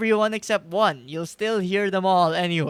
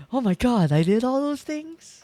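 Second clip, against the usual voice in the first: mock amazement; 6 semitones higher, 4 dB louder than usual. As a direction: pretend to be amazed at your own deeds. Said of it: "let's just keep going with him".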